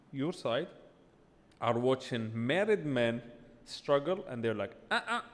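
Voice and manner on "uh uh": high-pitched imitation of men backing away; mock refusal. Guest voicing single men who watch married men struggle, mock refusal. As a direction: act out a comic, high-pitched no-way.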